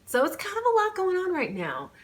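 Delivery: overwhelmed. Real, not acted. Overwhelmed by plot.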